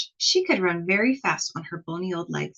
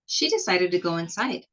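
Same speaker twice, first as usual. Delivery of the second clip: ordinary delivery, nothing marked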